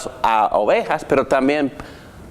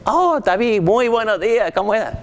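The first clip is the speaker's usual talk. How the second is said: much higher, chirpy mock wake-up greeting; mock loud greeting; 10 semitones higher than usual. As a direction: play the cheery neighbour calling out at dawn. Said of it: "no me lleguen a mi casa a las cinco y media de la mañana gritando".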